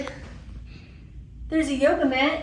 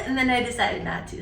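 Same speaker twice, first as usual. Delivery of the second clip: normal talking voice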